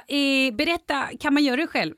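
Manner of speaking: high-pitched imitation of herself asking; put-on own voice. Podcaster re-enacting her question about doing it herself, high put-on voice. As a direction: ask it in an exaggeratedly high voice. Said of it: "säger jag med min röst då".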